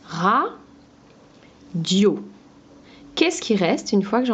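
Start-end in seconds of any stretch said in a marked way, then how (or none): 0.1-2.2 ra, pause, dio; découpe le mot syllabe par syllabe; syllabes détachées. Shown as a demonstration. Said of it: "je reprends mon radio". (syllabes bien séparées)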